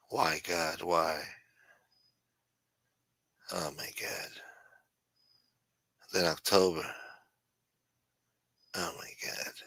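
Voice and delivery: despairing groans. Real, not acted. Streamer dreading September with his chat, low and hushed.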